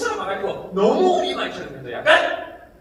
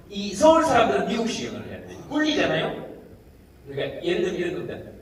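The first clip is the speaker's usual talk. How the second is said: usual speaking voice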